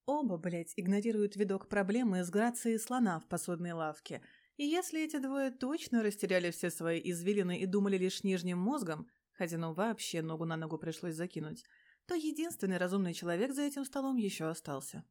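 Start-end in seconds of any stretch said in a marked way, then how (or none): none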